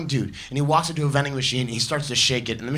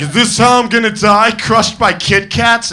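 high, loud dread, then the last words drawn out slowly; a doomed dude realising he'll be crushed by candy; hammed-up terror, slowing on the last phrase; mock panic; 9 semitones higher, 8 dB louder, slower than usual. Dude fearing death by machine.

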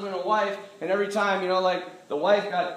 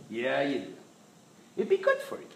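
tempting devil; 9 semitones lower than usual. Acted. Devil urging him to give in, wheedling. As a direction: coax in an odd, shifting voice. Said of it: "that's exactly how he sounds".

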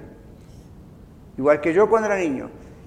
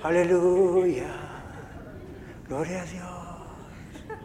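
slow, hushed sing-song hallelujah; drawn-out mock-holy praise, much softer; mock pious praise; 11 dB quieter, much slower than usual. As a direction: mimic a sugary saintly believer. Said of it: "están todo el día".

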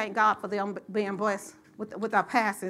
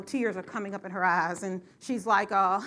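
usual speaking voice

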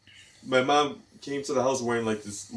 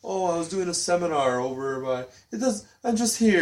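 much higher, flustered rambling; imitating mom; 8 semitones higher than usual. Grandma caught in a flimsy excuse, mock flustered voice. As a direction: stammer through a flimsy excuse. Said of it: "I'm like".

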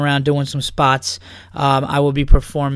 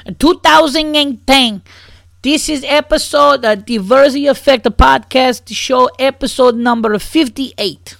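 higher, louder, slow show-intro voice; announcer read; 7 semitones higher, 5 dB louder than usual. Acted. Podcast host announcing the episode number in announcer voice.

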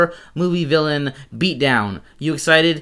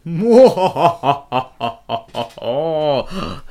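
drawn-out spooky villain laugh; 3 semitones higher, 4 dB louder than usual. Pretend. Host opens the Halloween episode, spooky laugh. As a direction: laugh long and theatrically for Halloween.

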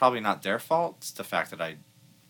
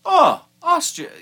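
put-on old man voice; 11 dB louder, slower than usual. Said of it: "The guy was like".

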